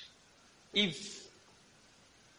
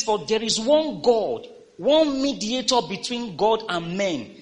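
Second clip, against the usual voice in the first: slow, high, loud preached reading; lifted scripture proclamation; 7 semitones higher, 7 dB louder, slower than usual. Acted. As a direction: proclaim each phrase from the pulpit. Reads scripture aloud.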